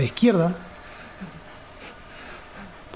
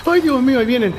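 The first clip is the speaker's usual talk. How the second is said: frightened cry; 6 semitones higher than usual. Real, not acted. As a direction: cry out as they come for you. Pursuers appear behind him. (grito asustado, más agudo y fuerte)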